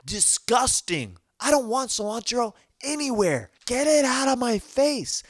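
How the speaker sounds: high, whiny voice of disgust; disgusted high rant. Acted. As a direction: whine about it, high and disgusted. Ranting against cilantro, high put-on voice.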